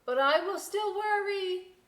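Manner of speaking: high, loud call after the departing boat; calling after her. A narrator's reading. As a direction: shout your worry after her. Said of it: "Lighthouse called after her".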